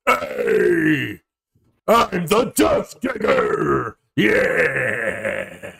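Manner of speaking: high, growling monster shouting. Acted. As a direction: roar it out like a boastful monster. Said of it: "the monster goes".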